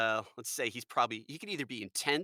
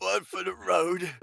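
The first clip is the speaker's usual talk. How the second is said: put-on loud, high, slow drunken toast; drunken goon; 7 semitones higher, 6 dB louder, slower than usual. Host offers a goon death line, slow loud drunk voice. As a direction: draw it out loudly like a dying boozer. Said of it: "You could even do the death sound".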